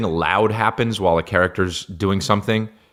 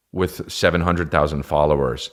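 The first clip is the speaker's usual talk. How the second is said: ordinary delivery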